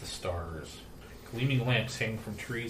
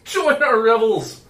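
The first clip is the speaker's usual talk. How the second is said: booming arrogant alien overlord; 10 semitones higher, 12 dB louder than usual. Acted. GM voices drunk elf lord.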